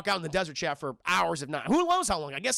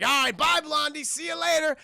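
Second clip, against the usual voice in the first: mock farewell; 8 semitones higher, 4 dB louder than usual. Tuco leaving Blondie mockingly.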